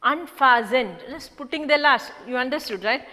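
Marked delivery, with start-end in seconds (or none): none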